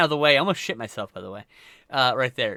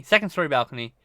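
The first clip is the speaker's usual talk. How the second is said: normal talking voice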